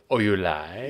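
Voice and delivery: a hint of a cartoon Italian; mock Italian accent. Cohost quoting the waiter, light mock Italian accent. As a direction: ask like a fawning Italian waiter. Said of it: "totally like the Dana Carvey".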